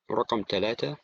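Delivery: normal talking voice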